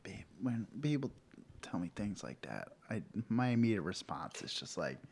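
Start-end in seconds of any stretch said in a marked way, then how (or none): none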